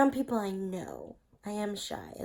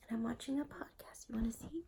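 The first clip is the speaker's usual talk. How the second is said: whispering; 2 semitones higher, 9 dB quieter, faster than usual. Real, not acted.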